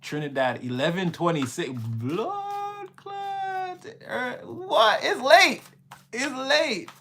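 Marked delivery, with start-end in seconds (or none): 1.7-6.9 high, startled outcry in mock patois; Caribbean exclamation. Real, not acted. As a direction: blurt it out in shock at the late hour. Reacting to Trinidad time.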